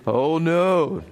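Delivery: mock gasped dismay. A narrator's reading. Little elephant hearing the hippo is waiting, mock dismayed gasp. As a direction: play up the dismay lightly. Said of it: "Norbert gasped".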